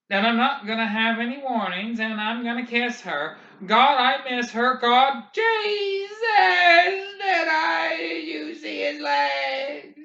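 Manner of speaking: high, loud, fast babble; shocked babbling. A narrator's reading. Garrity to McVries, high shocked babble. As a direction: gabble it in shock.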